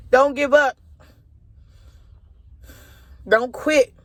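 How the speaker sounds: high, choked-up encouragement; father's words relayed with rising emotion; emotional urging. Real, not acted. Dad's remembered voice urging her on.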